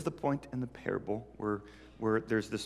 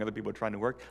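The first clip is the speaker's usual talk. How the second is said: usual speaking voice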